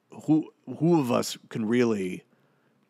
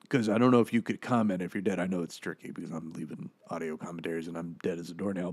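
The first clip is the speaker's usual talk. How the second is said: usual speaking voice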